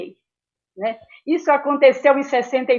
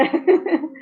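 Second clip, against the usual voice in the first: short laugh; 5 semitones higher than usual. Real, not acted. Laughs at her own eagerness.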